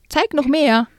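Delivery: mocking yell. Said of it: "brüllte er".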